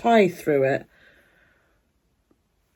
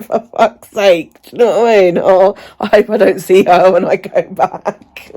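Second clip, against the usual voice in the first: exasperated; 7 dB louder than usual. Retold or imitated. Exasperated reaction to receptionist.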